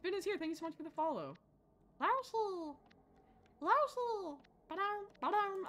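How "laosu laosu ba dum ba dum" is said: high-pitched cartoon creature voice; high, squeaky mascot impression; Yoshi. Shown as a demonstration. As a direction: chirp it high like a little game creature. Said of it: "I can do a perfect Yoshi impression".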